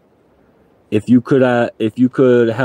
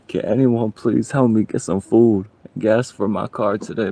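ordinary talk

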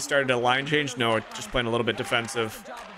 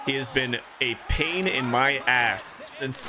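ordinary talk, nothing marked

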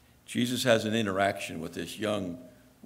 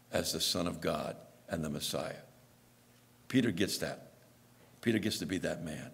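usual speaking voice

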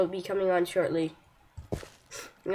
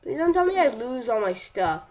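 usual speaking voice

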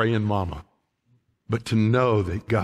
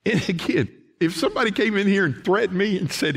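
ordinary talk, nothing marked

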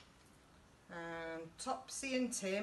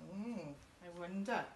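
usual speaking voice

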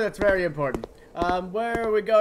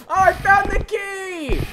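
beam it out, overjoyed; thrilled cry; 10 semitones higher, 4 dB louder than usual. Real, not acted. Praising the helpful monster, thrilled.

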